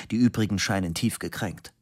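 usual speaking voice

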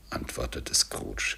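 ordinary delivery

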